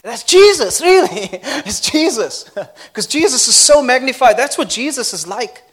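high, loud, laughing joy; excited praise. Real, not acted. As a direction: burst with delight over Jesus. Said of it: "excited praise".

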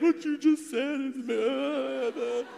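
high, very slow, tearful words; retold sobbing. Sobbing teen tells the ministers their word fits him. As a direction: drag it out through tears. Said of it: "So I come up sobbing".

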